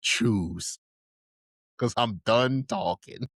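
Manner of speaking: deadly serious, long pause after the first word; slow, measured, final warning; Mikasa. Retold or imitated. Imitating the end of an ultimatum, deadly serious and slow. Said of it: "she was just talking, but she meant it".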